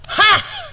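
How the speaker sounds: high, loud yelp of triumph; loud delighted ha; triumphant ha. Real, not acted. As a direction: shout a proud ha. Triumphant after her attempt.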